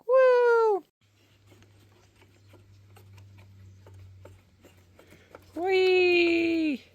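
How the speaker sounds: high gleeful woo and whee; excited whoop. Real, not acted. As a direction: cheer like on a ride. Cheering while working on organ.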